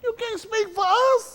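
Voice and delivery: rising preacher rebuke; high rebuke. Acted. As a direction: raise it and cut him off. Preacher rebuking Herschel Walker.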